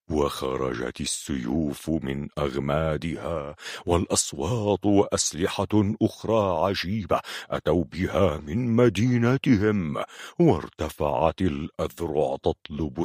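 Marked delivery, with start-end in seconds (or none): none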